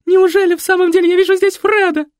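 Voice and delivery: high, loud, gushing cry; ecstatic yell. A narrator's reading. Nikoletta yelling in delight at Fred. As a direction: bellow in ecstatic disbelief. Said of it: "проорала Николетта".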